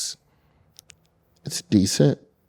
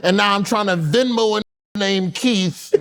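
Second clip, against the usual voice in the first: much higher, laughing, mock-frustrated rant; mock exasperated rant. Guest ranting at a friend whose car is towed, high and laughing. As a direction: pitch right up and vent in disbelief.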